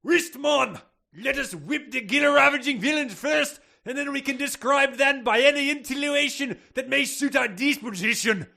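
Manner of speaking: loud, high-pitched hammed-up Scottish shout; Scottish accent. Aberdeen professor replying to the Irish one, shouted Scottish accent. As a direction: bellow it as an over-the-top Scotsman. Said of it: "Scottish guy that just has spoken".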